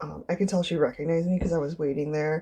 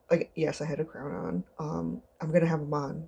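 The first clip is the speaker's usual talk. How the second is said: ordinary talk, nothing marked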